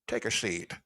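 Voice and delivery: lightly imitated TV host line; Chris Hansen. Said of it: "like Chris Hansen".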